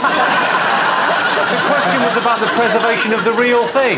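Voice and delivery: slow, laughing exasperation; host laughing while steering the panel back; exasperated laughing. Real, not acted. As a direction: drag it out, half laughing, to pull them back.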